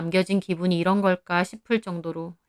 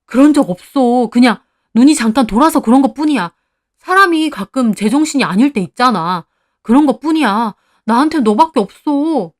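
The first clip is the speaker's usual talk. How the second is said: loud, higher-pitched whining excuses; desperate pleading; 4 semitones higher, 8 dB louder than usual. A narrator's reading. Husband excuses his affair to his wife, desperately pleading. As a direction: beg urgently, insisting it meant nothing.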